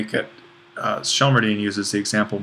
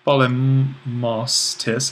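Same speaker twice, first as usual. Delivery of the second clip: polemos tis drawn out slowly and run together as one word; slowed as one word; much slower than usual. Shown as a demonstration. Teacher shows students the merged word, slow and careful. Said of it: "if we were to think of this as one word".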